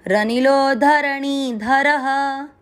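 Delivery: रनिलो धरणी धरह drawn out syllable by syllable; very slow recitation. Shown as a demonstration. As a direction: chant it very slowly, stress रनिलो. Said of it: "चौथा चरण repeat".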